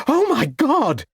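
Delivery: high shocked gasp of words; horrified exclamation. A narrator's reading. Lucy seeing the pram sink, high horrified cry. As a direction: cry out in horror. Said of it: "exclaimed Lucy".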